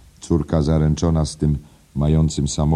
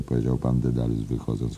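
plain reading voice

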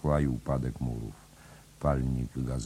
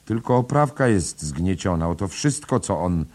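arguing; 5 semitones higher, 6 dB louder than usual. A narrator's reading. Bloom argues about damage.

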